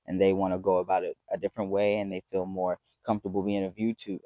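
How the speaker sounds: ordinary talk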